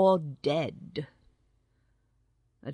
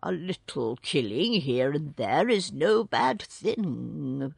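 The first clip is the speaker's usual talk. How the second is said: ordinary delivery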